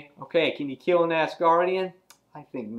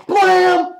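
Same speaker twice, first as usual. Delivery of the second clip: sudden high, loud blast noise; shouted comic-book bang; explosive blam; 10 dB louder than usual. Acted. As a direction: burst it out like an explosion.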